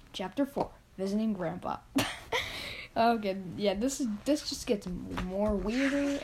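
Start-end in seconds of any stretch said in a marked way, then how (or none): 1.8-2.9 breathy laugh. Real, not acted. Reader laughs at story.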